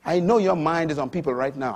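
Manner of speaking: ordinary talk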